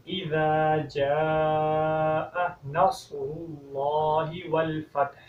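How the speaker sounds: slow melodic tilawat with a clear final h on والفتح; correct recitation. Shown as a demonstration. Teacher models the corrected first verse in recitation. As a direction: recite the whole verse slowly, all three sounds right. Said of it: "پڑھنے کا انداز یہ ہے،".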